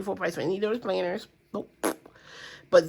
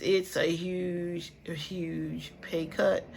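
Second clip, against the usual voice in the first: slow, drawn-out repeat, softer; slow emphasis; 7 dB quieter, much slower than usual. Real, not acted. Vlogger tells viewers about her pay cut, slow and heavy. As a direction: stretch every word to let it sink in.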